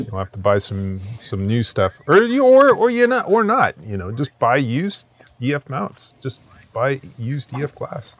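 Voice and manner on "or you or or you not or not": jumps way up high and loud; playful high-pitched stammer; high squeaky stammer. Pretend. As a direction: squeak out the fumbling words. Mock-stammering correction, high and loud.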